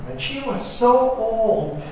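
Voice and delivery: ordinary delivery, nothing marked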